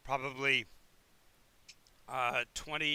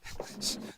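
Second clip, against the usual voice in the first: short, unvoiced mock chuckle; a small breath of giant laughter; giant's chuckle. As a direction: chuckle briefly as the fire giant. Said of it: "fire giant kinda chuckles at that".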